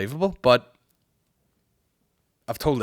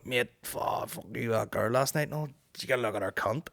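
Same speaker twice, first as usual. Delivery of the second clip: Northern Irish accent; 2 semitones higher, 4 dB quieter than usual. Shown as a demonstration. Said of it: "say it in a Northern Irish accent".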